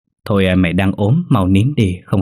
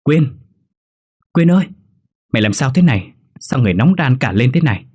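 higher-pitched worried calling; alarmed concern; 4 semitones higher than usual. Acted. Roommate finds her friend burning with fever, alarmed concern. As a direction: fret over a sick friend.

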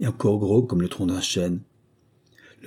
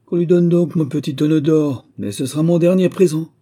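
much higher and louder booming command; commanding roar; 8 semitones higher, 7 dB louder than usual. A narrator's reading. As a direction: bellow the order at the spirits. The wind lord commands angrily. (ordre tonné, bien plus aigu et fort)

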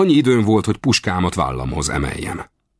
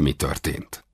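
ordinary delivery

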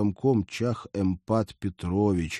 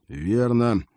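plain reading voice

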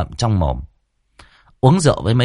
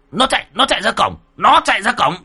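put-on much higher, hurried old man's shout; pointing urgently; 12 semitones higher, faster than usual. A narrator's reading. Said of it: "chỉ trò". (chỉ trỏ gấp gáp, giọng cao)

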